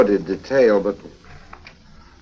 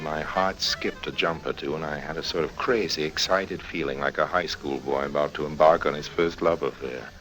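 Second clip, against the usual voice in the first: soft, low narration, drifting quieter; story narration; 4 semitones lower, 7 dB quieter than usual. A narrator's reading. Harry narrates his nervous feelings about Susie, in soft storytelling narration. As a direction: confess the nerves quietly to the listener.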